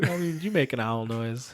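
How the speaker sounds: ordinary talk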